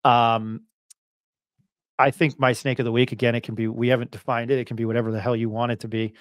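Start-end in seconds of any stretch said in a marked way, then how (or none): none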